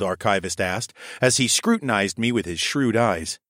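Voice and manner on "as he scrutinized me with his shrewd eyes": plain reading voice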